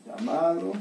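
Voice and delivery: ordinary delivery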